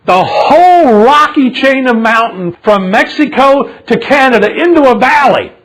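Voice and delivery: loud, high indignant sermon; full-force preaching. Real, not acted. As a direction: preach it out with outrage, pitch soaring on key words.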